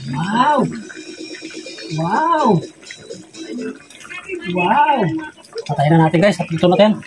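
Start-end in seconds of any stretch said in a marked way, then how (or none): 0.1-5.0 excited. Real, not acted. Reacting to the tasty soup.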